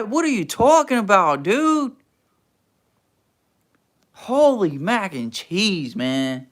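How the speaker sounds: normal talking voice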